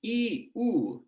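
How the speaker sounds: "ee" then "oo", held vowels; drawn-out sample vowels, lips rounding at the end; vowel demo. Shown as a demonstration. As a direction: move from front "ee" to rounded back "oo". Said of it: "going from heat to hoot".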